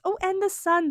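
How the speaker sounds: high, thrilled cry at the Sun card; light up with joy at the card; delighted. Real, not acted.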